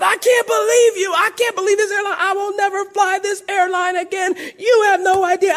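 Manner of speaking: high, loud, fast outraged yelling; angry shout. Retold or imitated. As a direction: blow up at the counter agent, near tears. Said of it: "I was pretty upset".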